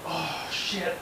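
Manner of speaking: muttered dismay. Retold or imitated. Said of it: "I'm like".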